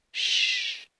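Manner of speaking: long, forceful shh; imitated drawn-out hush; shushing. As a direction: hush them with a sharp rush of air. Retold repeat of hushing.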